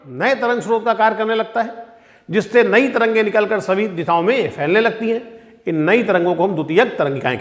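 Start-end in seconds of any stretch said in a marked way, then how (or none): none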